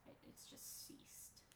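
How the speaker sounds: hushed whisper as the rumble stops. A narrator's reading. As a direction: breathe it out as everything goes still. Narrator setting the scene.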